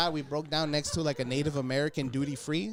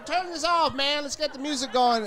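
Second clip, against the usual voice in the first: pushy heckler, pitched up; 11 semitones higher, 5 dB louder than usual. Retold or imitated. Comedian voicing the heckler ordering music off. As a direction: demand it like a drunk partier.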